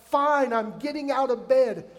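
loud, exasperated giving-in; grumpy teen. Retold or imitated. Kid answering the nagging parent, loud grumpy. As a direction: huff like a kid who gave up.

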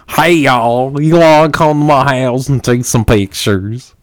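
hammed-up Wisconsin drawl that slides into a cowboy twang. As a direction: ham up a Wisconsin drawl until it turns cowboy. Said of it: "you just sound like a cowboy".

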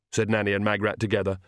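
ordinary delivery, nothing marked

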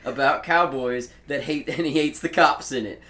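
laughing tease. Real, not acted. Co-host mocks Ramsey's cop-hating cowboy story, laughing. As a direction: needle him while cracking up.

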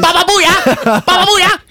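goofy catchphrase. Retold or imitated. Movie catchphrase shouted with laughs.

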